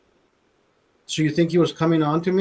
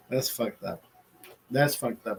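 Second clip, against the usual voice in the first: lower, much softer mutter; 6 semitones lower, 9 dB quieter than usual. Real, not acted. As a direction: mumble it under your breath. Muttering reaction after the joke.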